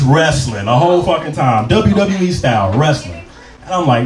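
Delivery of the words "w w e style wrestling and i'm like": ordinary talk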